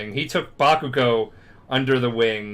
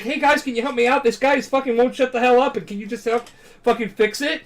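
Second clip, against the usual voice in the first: high, fast acted appeal for help; imitated urgent begging; urgent plea; 10 semitones higher, faster than usual. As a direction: rattle off a hurried, high-pitched request. Acts pleading with the bugs.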